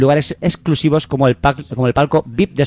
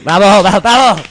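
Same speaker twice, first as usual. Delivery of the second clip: high, loud bravos building; mock ovation; 7 semitones higher, 5 dB louder than usual. Cohost applauding the con man, high loud bravos. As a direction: cheer him on like an opera crowd.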